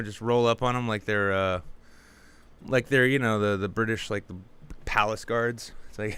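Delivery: ordinary talk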